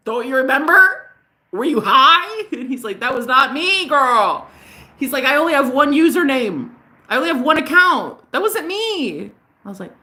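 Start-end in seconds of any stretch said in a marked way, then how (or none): 0.1-2.5 high, loud incredulous challenge; mock indignation. Indignant repeat in retold argument. 3.0-4.5 high, loud protest; retold emphatic denial. 5.2-9.3 high-pitched, insistent protest; retold emphatic denial.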